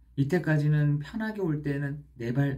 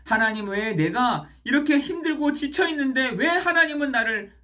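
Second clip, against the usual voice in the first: anguished outcry; 10 semitones higher, 6 dB louder than usual. Acted. Youth angrily questioning God.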